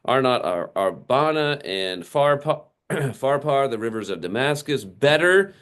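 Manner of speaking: high, indignant rant of a proud general; a slighted commander ranting in outrage; ranting Naaman. Acted. Naaman ranting at being snubbed by the prophet, high and indignant. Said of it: "he continues his rant".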